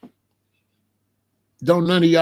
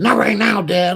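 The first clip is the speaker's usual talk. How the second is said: higher, louder irritated brush-off; mad daughter; 4 semitones higher, 4 dB louder than usual. Retold or imitated. College daughter brushing off Dad's phone call, mad. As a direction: imitate a fed-up daughter cutting Dad off. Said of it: "Oh, she got mad".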